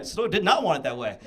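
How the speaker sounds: ordinary talk, nothing marked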